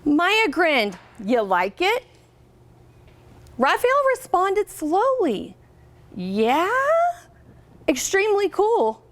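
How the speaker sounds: storybook reading voice, lively and clear; picture book read-aloud; reading to children. Acted. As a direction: read it out to a class of third graders.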